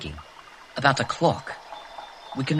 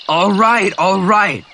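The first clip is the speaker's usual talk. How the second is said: higher, louder irritated giving in; grudging concession; 5 semitones higher, 6 dB louder, slower than usual. Acted. Jack forced to yield to Ralph, grudging concession. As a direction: give in through clenched resentment.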